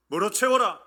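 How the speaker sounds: loud, punched call to the congregation; loud prompting question. Real, not acted. Preacher prompting congregation for the answer, loud. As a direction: fire the question out loudly.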